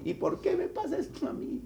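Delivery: high-pitched mock protest; put-on indignant whine at God; mock complaining. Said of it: "y pelee y reclame".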